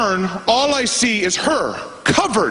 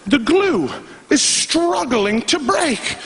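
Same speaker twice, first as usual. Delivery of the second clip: slow, high, drawn-out struggle; high and laboured, at half speed; straining; 8 semitones higher, much slower than usual. Retold or imitated. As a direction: stretch each word as if the glue won't give. Acting out the straining tape glue.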